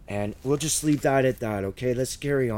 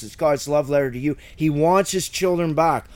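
ordinary talk

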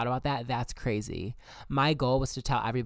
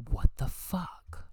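whispered, drawn-out disbelief; startled whisper; 6 semitones higher, much slower than usual. Retold or imitated. Questioning a voice in your head, startled whisper. Retold startled inner reaction.

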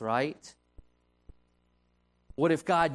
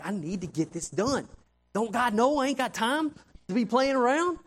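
frustrated complaint; 6 semitones higher than usual. Retold or imitated. Retelling his own frustrated complaint.

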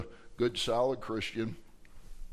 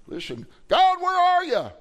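high, loud desperate plea; crying out to God; 11 dB louder than usual. Shown as a demonstration. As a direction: cry out to heaven, lost and loud. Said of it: "tell him".

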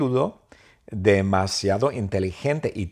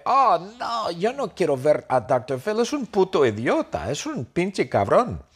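much higher, indignant squeal; whiny Tom refusing; 8 semitones higher than usual. Acted. Tom refusing to see doctor Phil, high indignant squeal.

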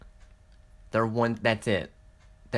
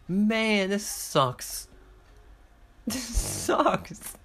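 whiny; 9 semitones higher, much slower than usual. Real, not acted.

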